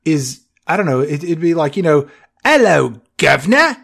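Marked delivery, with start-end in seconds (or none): 2.4-3.8 high, hammed-up Cockney British accent; bad British accent. Shown as a demonstration. Podcaster showing a truly bad accent, mock Cockney British. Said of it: "To call it a bad accent".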